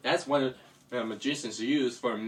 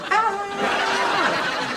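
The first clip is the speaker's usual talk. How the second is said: high scream, rising in loudness; storybook yell; 7 dB louder than usual. Acted. Shocked bunny loses his machine, high loud yell.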